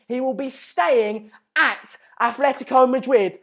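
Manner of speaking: ordinary talk, nothing marked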